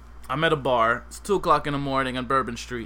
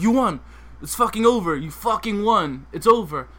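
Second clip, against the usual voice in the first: higher, louder shouting to end it; breaking up a bar fight with a shout; retold yell; 4 semitones higher, 3 dB louder than usual. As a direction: bark that the fight is over. Stopping fight, yelling.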